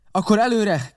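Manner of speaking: high, urgent command; called-out order. A narrator's reading. Captain ordering the boat onward, calling out. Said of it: "kiáltotta a kapitány".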